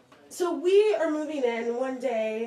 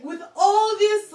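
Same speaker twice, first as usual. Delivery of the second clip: normal talking voice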